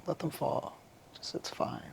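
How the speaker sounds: soft, near-whispered reassurance to herself; soothing whisper. Retold or imitated. Talking herself down, whispered and soft. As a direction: ease the words out under the breath.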